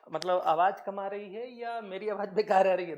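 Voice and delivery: loud, pressing question to the class. Real, not acted. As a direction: raise the voice to be heard. Asking students about mic sound.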